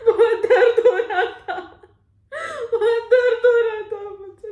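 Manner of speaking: loud, high wail of pain; agonised crying. Acted. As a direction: cry out through the pain. Reliving severe pain. (दर्द से बिलखकर रोना)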